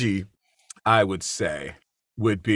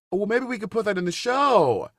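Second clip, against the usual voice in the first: teasing quip; 10 semitones higher than usual. Retold or imitated. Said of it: "Alan said".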